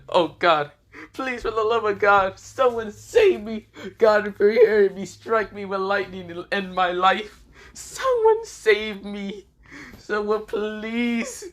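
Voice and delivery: high, slow desperate pleading; begging for help. Acted. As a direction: beg heaven melodramatically. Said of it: "Issei said".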